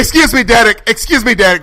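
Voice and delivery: lightly voiced indignant actress; Cara Delevingne. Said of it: "My name is Cara Delevingne".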